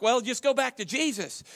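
ordinary delivery

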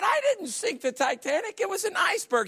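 high, exasperated protest; indignant pilot. Retold or imitated. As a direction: protest in mock outrage.

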